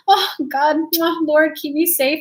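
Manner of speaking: mock scared. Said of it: "And I got kinda scared".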